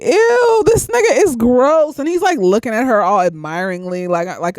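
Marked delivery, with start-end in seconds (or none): 0.0-2.0 higher, louder disgusted outburst; reacting on screen to the old man, grossed out. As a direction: recoil in disgust out loud. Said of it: "I was just like".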